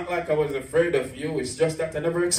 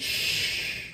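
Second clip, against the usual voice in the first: whispered shh; a breathy unvoiced shush; hush; 7 dB quieter than usual. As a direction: hush them sharply. Hushing the monsters in poem.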